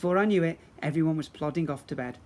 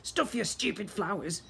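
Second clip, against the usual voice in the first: spat insult; 5 semitones higher than usual. Acted. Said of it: "Grogber spat at him".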